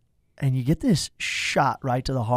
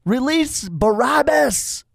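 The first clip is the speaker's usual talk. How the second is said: 1 crowd call; 9 semitones higher, slower than usual. Retold or imitated. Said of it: "and it says".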